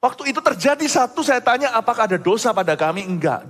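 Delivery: high, loud sermon delivery; impassioned preaching. Real, not acted. Preacher tells congregation not to be shocked, forceful preaching.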